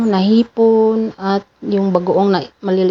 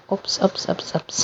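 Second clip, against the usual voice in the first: whispered oops; 8 dB quieter than usual. Real, not acted. Car dips, startled oops.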